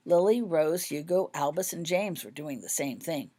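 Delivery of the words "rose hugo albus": plain reading voice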